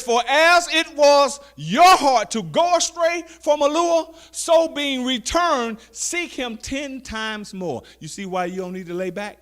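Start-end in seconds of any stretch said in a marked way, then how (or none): 0.0-7.8 high, loud, slow preacher's call; preaching scripture. Acted. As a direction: proclaim it to the congregation. Scripture read at full force.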